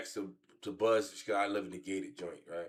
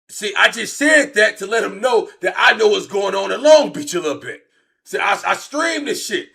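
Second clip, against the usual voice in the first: much higher and louder hyped explaining; worked-up rant; 10 semitones higher, 13 dB louder than usual. Real, not acted. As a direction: keep ranting at full steam. Still worked up after story.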